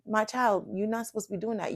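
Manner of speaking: a hint of tender fatherly warning; gentle inner voice. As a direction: voice the Spirit's soft correction. Voicing the Holy Spirit gently.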